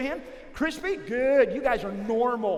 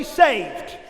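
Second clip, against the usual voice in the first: called out; 7 dB louder than usual. Shown as a demonstration. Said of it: "everybody say".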